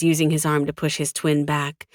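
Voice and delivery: usual speaking voice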